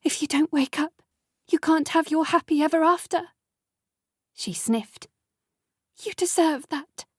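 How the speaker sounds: high, tearful pleading; a hint of a crying girlfriend. A narrator's reading. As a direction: speak through tears, a touch of sobbing. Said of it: "sobbing as she walked".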